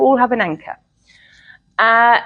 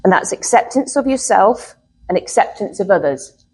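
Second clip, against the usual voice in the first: ordinary talk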